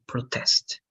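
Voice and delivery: "protest" stressed on TEST, the verb; stress on second syllable. Shown as a demonstration. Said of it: "if the stress is in a different part".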